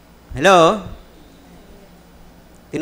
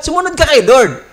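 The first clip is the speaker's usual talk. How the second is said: preaching emphasis; 6 semitones higher, 5 dB louder than usual. Real, not acted.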